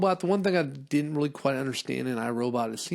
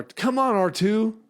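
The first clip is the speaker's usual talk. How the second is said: high, louder impatient call; impatient Luke; 10 semitones higher, 6 dB louder, slower than usual. Pretend. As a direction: hurry the droid along. Luke scolding droid.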